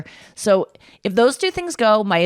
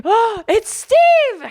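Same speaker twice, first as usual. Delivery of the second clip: much higher, louder, stretched-out thrilled cry; excited squeal; 13 semitones higher, 5 dB louder, much slower than usual. Retold or imitated. In the car with Anya, thrilled that Steve has written, a high, loud excited cry. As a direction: gasp out his name like the best news ever.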